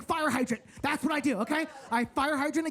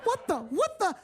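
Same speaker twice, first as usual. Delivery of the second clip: surprised; 8 semitones higher than usual. Retold or imitated. Fighting girls startled.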